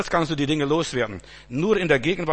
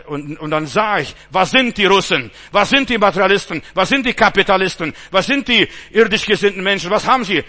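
loud, pointed questions to the crowd; rhetorical sermon; 4 semitones higher, 7 dB louder than usual. Acted. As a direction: challenge the congregation. Rhetorical questions against materialists.

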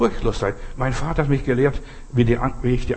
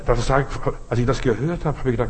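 normal talking voice

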